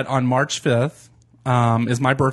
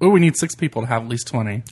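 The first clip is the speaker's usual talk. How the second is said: ordinary talk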